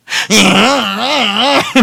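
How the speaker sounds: high, drawn-out wobbly mock wail; exaggerated wordless whining; mock whine. Shown as a demonstration. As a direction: act out a childish tantrum noise. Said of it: "막 이러고 안 돼".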